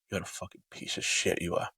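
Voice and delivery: hissed under the breath, biting each word; cold hissed insult. Retold or imitated. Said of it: "looked me dead in the eye and was like".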